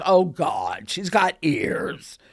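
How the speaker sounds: put-on Mary realizing the girl has a gift, slowly; Mary's dawning realization. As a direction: draw it out slowly in dawning dismay. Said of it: "And Mary".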